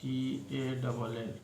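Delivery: letters read slowly one by one; dictating letters slowly; spelled out slowly. Shown as a demonstration. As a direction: spell the word slowly for the class. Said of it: "टॉल लिखते हैं".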